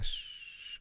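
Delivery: soft, drawn-out "shh" hiss; imitated hiss. Shown as a demonstration. Showing viewers the background hiss, a quiet shh. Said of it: "if you hear the hissing sound".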